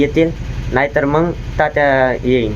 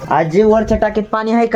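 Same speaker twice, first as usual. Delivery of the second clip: a higher call to grandma; 7 semitones higher than usual. Real, not acted. Calling out to grandma about the water tank. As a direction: call up to her across the house.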